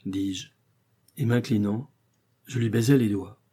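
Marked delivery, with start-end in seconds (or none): none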